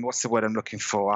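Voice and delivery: ordinary talk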